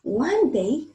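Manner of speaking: ordinary delivery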